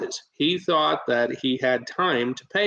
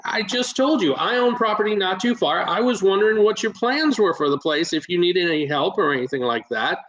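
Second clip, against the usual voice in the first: animated sales pitch in a raised pitch; match the homeowner's energy, speaking up; speaking up, excited; 5 semitones higher than usual. Retold or imitated. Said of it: "I'm speaking up. I'm, I'm more excited sounding".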